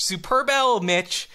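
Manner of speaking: ordinary talk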